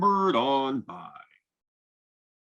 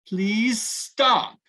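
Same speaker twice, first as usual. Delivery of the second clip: high, louder plea of "stop"; crying out; 7 semitones higher, 4 dB louder than usual. Acted. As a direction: call out to the giants. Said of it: "Greta cried".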